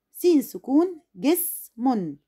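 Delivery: جسم stretched slowly, جس held as one syllable; slow teacher demo of جسم; drawn-out syllable demo. Shown as a demonstration. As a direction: spell out جسم slowly for the class. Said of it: "نطقت السين الساكنة مع الجيم مقطع على بعض".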